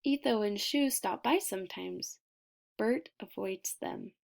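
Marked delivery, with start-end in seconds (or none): none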